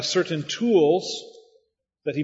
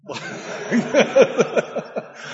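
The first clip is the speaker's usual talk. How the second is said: high breathless laugh; a knowing laugh from behind him; wife's knowing laugh; 7 semitones higher, 4 dB louder than usual. Retold or imitated. Wife laughs as the pastor calls her husband bitter: high, breathy laugh.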